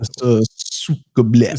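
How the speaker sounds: slow mock Russian accent; thick Russian accent. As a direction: drawl it in a hammy Russian accent. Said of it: "Just do a, a thick Russian accent".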